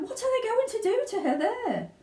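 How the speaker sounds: very high worried plea; panicked question; fearful mother. Acted. Mother begs Wonka to explain, fearful. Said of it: "cried Missus Beauregard".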